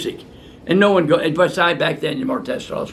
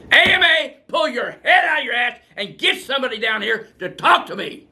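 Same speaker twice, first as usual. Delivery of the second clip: real outrage thrown at the AMA; angry shout; 8 semitones higher than usual. Real, not acted. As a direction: yell your challenge straight at them. Ranting directly at the AMA.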